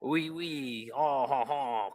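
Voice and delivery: a jokey French accent, lightly put on; mock French accent. Joking about French accents to chat, mock French accent. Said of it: "German and French accents".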